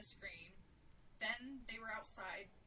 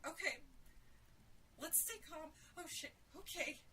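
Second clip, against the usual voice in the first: high, nervous self-soothing; trying to stay calm; 7 semitones higher than usual. A narrator's reading. Sarah to panicking Jill, anxiously trying to stay calm. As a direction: hold the panic down. Sarah tries to steady herself.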